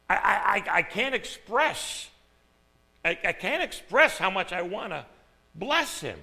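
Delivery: building fervour; overwhelmed praise. Real, not acted. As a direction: reach for words you can't find.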